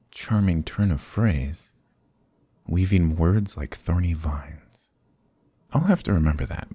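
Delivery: ordinary talk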